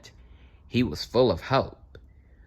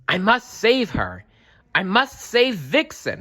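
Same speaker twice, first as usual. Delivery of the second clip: determined fox; 12 semitones higher, 4 dB louder than usual. A narrator's reading. Fox resolve.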